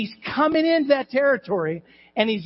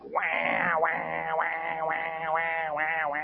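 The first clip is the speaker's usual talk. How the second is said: droning, softer "wah wah" of complaint; mock whining wail; 3 semitones lower, 8 dB quieter, much slower than usual. Preacher mimicking believers facing a test, mock whining. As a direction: imitate a crybaby on one long note.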